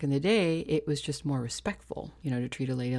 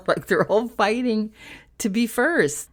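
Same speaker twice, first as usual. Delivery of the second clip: laughing; 7 semitones higher, 7 dB louder, slower than usual. Real, not acted. Laughing at sperm race image.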